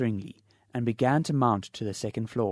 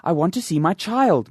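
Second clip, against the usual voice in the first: loud, higher near-shriek; almost shrieking; 6 semitones higher, 9 dB louder than usual. Acted. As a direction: nearly scream it. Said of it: "Mister Button almost shrieked".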